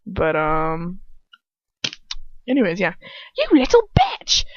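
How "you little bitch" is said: very high, loud roar in a put-on accent; Dolohov's roar. A narrator's reading. Dolohov roars, stopping their hilarity dead, high angry roar. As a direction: roar the insult, pitch leaping way up. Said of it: "Dolohov roars".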